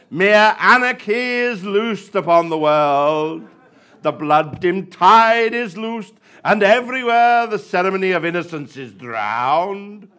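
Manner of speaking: slow, loud hammy declaiming; bad acting. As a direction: overblown ham actor reciting poetry. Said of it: "I was doing my bad actor voice there".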